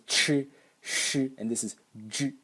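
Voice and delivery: ch, then sh as pure hiss, then j as a slightly voiced burst; ch sh j contrast. Shown as a demonstration. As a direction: break ch into sh and j. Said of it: "this is only the explosion".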